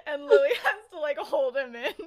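high, giggling words; laughing through it. Real, not acted. Teller still laughing at the scene.

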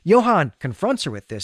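ordinary delivery

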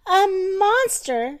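high, drawn-out cry of alarm; storybook shout of fright. A narrator's reading. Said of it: "shouted Baby".

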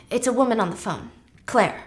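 usual speaking voice

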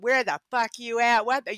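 mock-angry demand, lightly hammed up; retold anger. Reading out Tony's letter, retold anger. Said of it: "nothing but anger".

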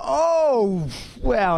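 high exclaimed oh wow; loud high startled oh wow; surprised. Retold or imitated. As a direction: gasp of surprise at the drop. Surprised on seeing steep slope.

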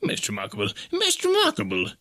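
marvelling professor. A narrator's reading. Professor finding his arm intact, mounting wonder. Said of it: "he said".

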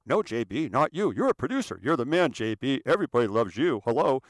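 loud mock sycophant; butter up the boss loudly; hammed-up yes-man reassurance; fawning flattery. Crew flatters the producer.

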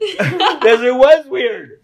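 high, loud words through laughter; laughing. Real, not acted. Laughing while retelling.

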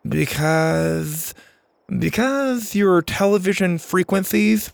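lisping, improvised alien explanation; stall, then bluff it through with a lisp; Max bluffing as an alien. A narrator's reading. Max covers his slip to the driver, bluffing haltingly.

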